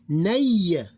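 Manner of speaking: Nay drawn out, gliding from "a" into "y"; diphthong demo. Shown as a demonstration. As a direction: stretch Nay loudly as one gliding diphthong. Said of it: "This one is diphthong".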